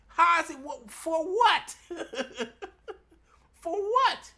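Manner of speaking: high, loud disbelief; shrill-free squeal of disbelief, pitch jumping up; incredulous. Real, not acted. Ranting at Pete, high incredulous for what. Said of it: "why do you believe him".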